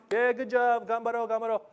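high, sing-along bench cheer; mock cheerleader. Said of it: "to be like cheerleaders".

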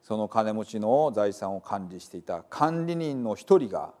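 ordinary delivery, nothing marked

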